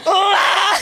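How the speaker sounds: high crying shout; hammed-up bawling cry; mock wail. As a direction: let out a sobbing wail. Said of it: "bawling my eyes out".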